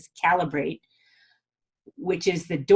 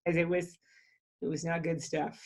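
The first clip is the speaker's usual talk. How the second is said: weepy; 3 semitones lower, 9 dB quieter than usual. Real, not acted. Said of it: "I'm still a little weepy about it".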